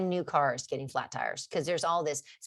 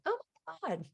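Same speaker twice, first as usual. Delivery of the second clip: much higher, sarcastic gushing; mock delight; 7 semitones higher than usual. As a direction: gush as if stress were a treat. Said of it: "I don't think anybody is like".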